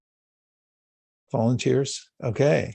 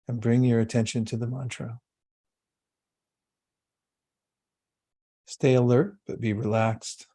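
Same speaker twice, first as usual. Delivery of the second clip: guided meditation. Acted. Yoga teacher guiding the class into calm meditation. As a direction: ease the group into stillness.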